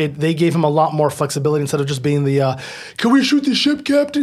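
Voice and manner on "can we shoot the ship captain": much higher, eager mock voice; Worf. Worf asking the captain to open fire, eager. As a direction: plead like an over-keen subordinate. Mocking Worf's one-note role.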